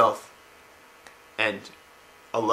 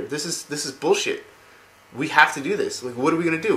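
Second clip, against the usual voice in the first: higher, heated retelling of an argument; exasperated insistence; 5 semitones higher than usual. Retold or imitated. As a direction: insist as the frustrated prince. Arthas insists in retold quarrel.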